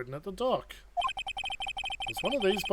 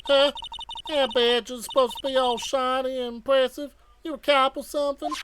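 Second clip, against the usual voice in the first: put-on Southern accent; 8 semitones higher, 5 dB louder than usual. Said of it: "my best Southern Belle voice".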